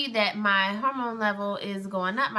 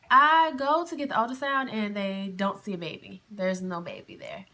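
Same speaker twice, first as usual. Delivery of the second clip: ordinary talk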